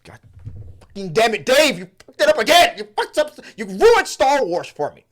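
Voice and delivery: high, loud mock rant at the tuba player; hammed-up screaming conductor; conductor's furious outburst. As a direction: yell at Dave like a fed-up conductor. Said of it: "he's like, he's like".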